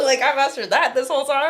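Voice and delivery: mock pride breaking into laughter; laughing sarcastically. Real, not acted. To her friend, laughing at her own Arabic. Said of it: "laughing at herself".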